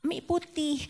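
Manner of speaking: put-on a light touch of a toddler's high voice; little child's voice. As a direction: lightly voice the small child's answer. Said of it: "Anak kecil ini menjawab".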